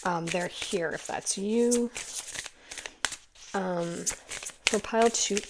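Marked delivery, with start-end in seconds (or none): none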